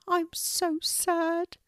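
high, slow, sorrowful little pelican voice; sad pelican Moon. Acted. Moon tells Kelty how sad she feels, high and slow. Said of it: "Moon the sad pelican speaks".

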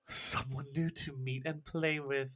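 slow, soft creepy voice; eerie playful predator. Acted. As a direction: savour it slowly and quietly. Game master voices eerie Agnes.

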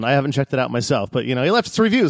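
ordinary talk, nothing marked

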